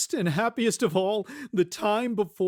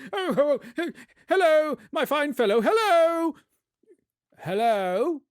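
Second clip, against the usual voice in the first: high, laughing calls down to the street; excited hailing; 9 semitones higher, 4 dB louder than usual. Acted. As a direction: hail the boy, bursting with joy. Scrooge hailing the boy.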